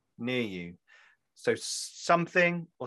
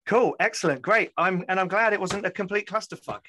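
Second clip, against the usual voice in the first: glad, excited; 8 semitones higher, 4 dB louder than usual. Real, not acted. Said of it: "As Shara Dell's put".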